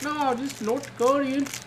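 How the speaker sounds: very high, slow North Dakota drawl; hammed-up North Dakota buddy voice; North Dakota accent. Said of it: "that's how my buddy from North Dakota talks".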